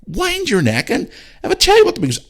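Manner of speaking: high, heated outburst at parents; exasperated rant. Real, not acted. Podcaster ranting at parents who indulge cat kids, high and exasperated. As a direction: voice shooting up high in disbelief.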